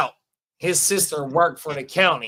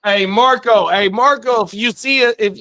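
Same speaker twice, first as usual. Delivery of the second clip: loud call toward someone far off; 5 semitones higher, 9 dB louder than usual. Real, not acted. Host hails friend Marco through the stream, loud call. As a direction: raise the voice to hail a friend.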